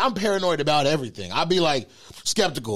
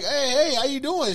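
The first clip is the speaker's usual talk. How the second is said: high mock greeting; 10 semitones higher than usual. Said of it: "So I'm like".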